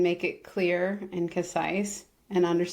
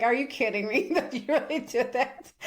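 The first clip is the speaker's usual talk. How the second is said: laughing; 6 semitones higher than usual. Retold or imitated. Retold reply, laugh heard.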